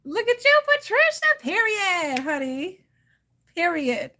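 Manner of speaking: high, loud hype for a viewer; excited praise. Real, not acted. Hyping up Patricia in the chat, excited and loud. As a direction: gush over her like a proud big sister.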